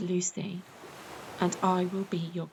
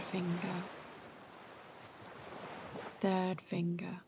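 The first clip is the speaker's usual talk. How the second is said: fading softer; 9 dB quieter than usual. Real, not acted. Body scan sinking deeper.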